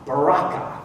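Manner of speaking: "Baraka." three clear syllables, rolled r, rising end; Hebrew pronunciation. Shown as a demonstration. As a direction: model "Baraka." for the congregation to repeat. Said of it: "Three syllables".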